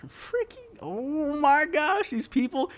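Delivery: slow, high, laughing disbelief; drawn-out high oh my gosh in disbelief; exasperated disbelief. Real, not acted. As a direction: laugh at how absurd it is.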